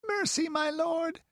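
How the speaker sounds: put-on very high, tearful child's begging; pitiful high wail for mercy; sobbing plea. A narrator's reading. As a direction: plead through sobs in a small high voice. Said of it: "sobbed Tina".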